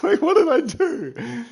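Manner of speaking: high laughing wait what did I do. Real, not acted. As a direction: laugh through the question. Laughing at being called clueless.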